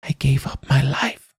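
put-on high, whispery old woman; quiet, deflated. A narrator's reading. Old woman telling what truth cost her, quiet and deflated. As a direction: whisper it high, like a spent crone. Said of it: "She spoke quietly now, deflated again".

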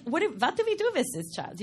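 imitating baffled parents with German accents. Daughter imitating puzzled parents, German accent. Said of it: "they just were like".

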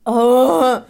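loud, high exclamation; loud surprised oh. Retold or imitated. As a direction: blurt it out in surprise. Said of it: "É tipo".

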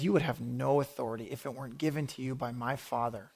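low, soft retold answer; calm, quiet reply. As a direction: answer the challenge quietly, voice dropped. Jesus calmly answers Pilate's challenge.

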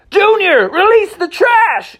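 very high, loud order; high urgent horse shout; urgent command. Acted. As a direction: bark the order. Mustang commanding.

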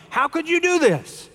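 high-pitched mock indignation; indignant tourist. Tourist scolding the contented fisherman, indignant. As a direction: act affronted, pitch jumping way up. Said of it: "the tourist was indignant".

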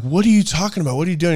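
high-pitched incredulous questioning; incredulous retort. Retold or imitated. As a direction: quote baffled onlookers, pitch jumping up. Others question his surprising sacrifice.

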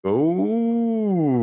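high, loud teasing ooh; intrigued ooh. Real, not acted. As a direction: perk right up at the hint of a secret. Teased about a secret dwarven project.

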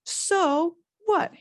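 high sharp defiant retort; Harry snapping back at his friends; shouted. A narrator's reading. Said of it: "Harry shouted".

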